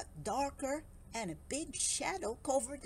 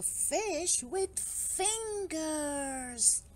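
slow, stretched-out storyteller reveal; drawn-out reveal; 4 semitones higher, much slower than usual. A narrator's reading. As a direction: draw the words out for wonder. Dramatic reveal of Barry's oddity.

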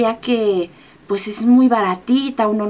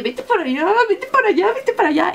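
imitating urging; 8 semitones higher than usual. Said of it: "me estaba empujando así de".

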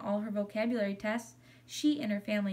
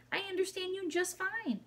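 high-pitched squeaky hamster voice; frantic squeak; 10 semitones higher than usual. A narrator's reading. Hamster insists to the girl that he understands, frantic squeaking. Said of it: "I frantically squeaked".

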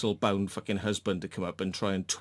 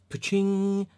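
mock ding; 10 semitones higher, 3 dB louder than usual. Real, not acted. Light bulb moment sound.

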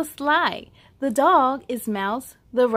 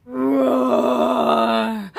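louder roared word, held long; lion roar; 7 dB louder than usual. Acted. A lion hiding in the bushes roars at the kids, loud. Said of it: "That sounds like a lion".

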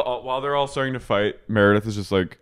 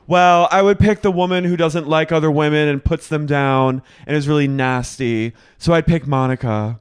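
high, loud catty jab; loud mocking; 6 semitones higher, 6 dB louder than usual. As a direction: say it loud and snide. Lisa quoted in a fight.

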